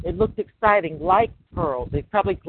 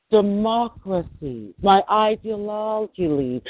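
drawn-out, slow pitch of a superpower; lightly voiced nation making an offer; slow mock America pitch; 3 semitones higher, much slower than usual. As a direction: stretch policy out slowly like a sales line. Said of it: "That's how we talk".

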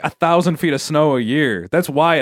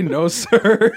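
words broken up by laughter; helpless real laughing; 5 semitones higher than usual. Real, not acted. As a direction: laugh while repeating it, pitch up. Laughing while repeating the joke.

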